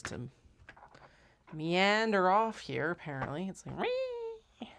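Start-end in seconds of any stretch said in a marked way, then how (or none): 3.8-4.7 tiny high-pitched "wee"; imitated tool squeal. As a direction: mimic a little squeaky noise. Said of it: "it totally made that noise".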